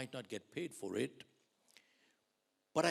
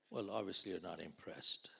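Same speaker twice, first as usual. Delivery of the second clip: hushed teasing whisper to the crowd; whispered aside; 5 semitones lower, 6 dB quieter than usual. Real, not acted. Speaker teasing an unimpressed audience, whispered aside. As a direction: drop to a sly whisper.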